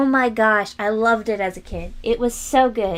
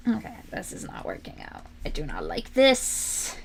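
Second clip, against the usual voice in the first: frustrated mutter; 7 semitones lower, 6 dB quieter than usual. Real, not acted. Muttering while struggling.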